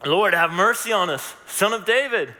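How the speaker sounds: loud, high desperate plea; loud pleading cry. Acted. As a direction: call out for mercy. Blind men crying out.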